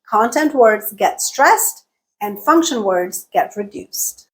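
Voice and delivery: normal talking voice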